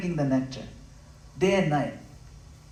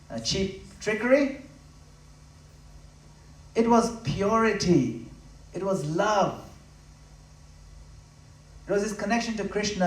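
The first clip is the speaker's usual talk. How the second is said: louder, higher, declaiming each phrase; fiery preaching; 5 semitones higher, 4 dB louder than usual. Acted. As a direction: preach it out to the hall with full force. Preaching climax on Prabhupada's secret.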